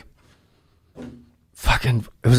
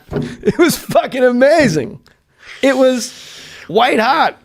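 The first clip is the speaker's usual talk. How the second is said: laughing excitedly; 8 semitones higher, slower than usual. Real, not acted. Laughing praise.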